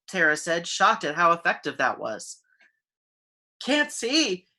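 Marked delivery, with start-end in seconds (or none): 3.6-4.5 panicked. A narrator's reading. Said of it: "he cried on the verge of panic".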